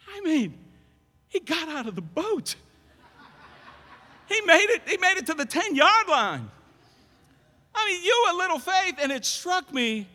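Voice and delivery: loud, high-pitched preaching in disbelief; impassioned protest. Real, not acted. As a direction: argue Peter's case at full voice.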